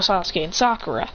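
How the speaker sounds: plain reading voice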